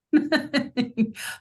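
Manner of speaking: a quick bright laugh, pitch jumping up; short laugh. Real, not acted. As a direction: laugh it off lightly, then move on. Laughs about career switch.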